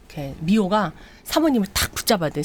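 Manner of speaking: ordinary delivery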